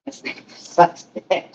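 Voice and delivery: whispered high, slow grumble at the gadget; mock angry mutter. Real, not acted. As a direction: hiss a fed-up curse under the breath. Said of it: "We're about to see Angry [name]".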